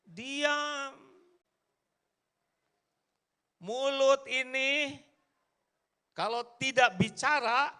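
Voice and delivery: high, loud, emphatic sermon voice; fiery preaching. Real, not acted. Preacher to the congregation, fiery and loud. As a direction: drive the point home, pounding each phrase.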